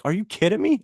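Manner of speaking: high incredulous mock; high-pitched incredulous question; disbelief. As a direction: replay his own disbelief. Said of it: "I'm like".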